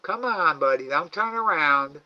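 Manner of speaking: high, loud sing-song coaxing of an animal; coaxing pet talk. Real, not acted. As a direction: coax the shy raccoon to come and eat. Coaxing the raccoon.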